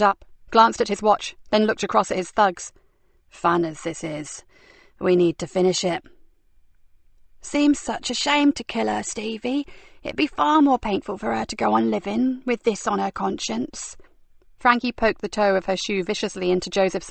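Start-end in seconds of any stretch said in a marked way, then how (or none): 3.3-6.0 a bored killer winding things up; cold villain. Acted. 7.4-14.0 sneering villainess. Acted. Frankie's voice, mocking.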